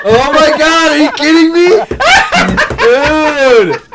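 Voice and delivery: screaming with laughter; laugh-shout in disbelief; shocked laughing shout. Real, not acted. Shocked reaction to game.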